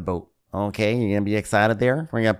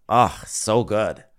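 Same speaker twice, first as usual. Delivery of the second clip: normal talking voice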